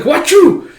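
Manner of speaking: very high, loud fake sneeze; mock sneeze. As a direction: burst out with a squeaked sneeze. Acts out gas attack sneeze.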